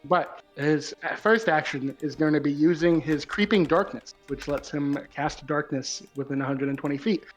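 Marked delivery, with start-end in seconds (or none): none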